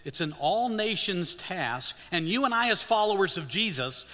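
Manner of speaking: ordinary talk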